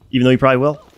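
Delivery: ordinary delivery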